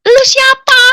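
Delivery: loud, very high challenge; retold yell at an unseen speaker; exasperated demand. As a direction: demand an answer, voice shot up. Demands who the voice is.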